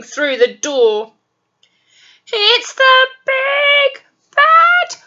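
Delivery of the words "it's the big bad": high, loud, slow panicked shout; terrified shout. Acted. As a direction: scream it in terror. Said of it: "they cried".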